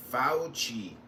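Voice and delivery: Fauci said low as FOW-chee; corrected pronunciation. Shown as a demonstration. Telling someone to get it right, Fauci as low FOW-chee. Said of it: "Get it right".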